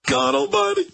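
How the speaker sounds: high, laughing God almighty; exasperated host laughing at the news; laughing exclamation. Real, not acted. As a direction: laugh it out in disbelief.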